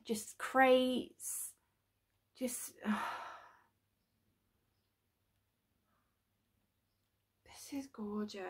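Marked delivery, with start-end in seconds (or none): 2.4-8.4 whispering. Real, not acted. Awed by the model.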